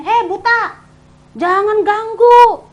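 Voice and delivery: loud, high rebuke, drawn out slowly; crowd scolding. Acted. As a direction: tell off a beggar harshly.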